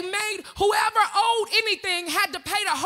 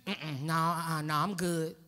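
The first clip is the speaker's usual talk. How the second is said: much lower, soft brush-off; dismissive murmur; 13 semitones lower, 8 dB quieter, slower than usual. Retold or imitated. Guarded person turning away someone offering love, low and soft. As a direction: mutter a guarded refusal, low.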